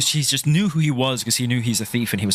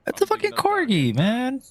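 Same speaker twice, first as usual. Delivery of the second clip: excited; 13 semitones higher than usual. Real, not acted. Spots the corgi on screen.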